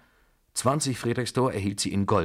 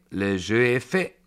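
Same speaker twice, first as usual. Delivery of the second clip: loud, projected French announcement; rising, sing-out table cry; croupier's call; 3 semitones higher, 6 dB louder than usual. A narrator's reading. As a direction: declare betting closed to the whole table. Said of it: "rief der Croupier".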